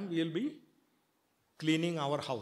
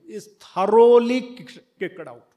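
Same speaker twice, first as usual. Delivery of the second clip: sudden loud, slowed "thoroughly kick-"; emphatic burst; 2 semitones higher, 13 dB louder than usual. Real, not acted. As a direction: throw the cheating religion out with force. Emphatic rejection of cheating religion.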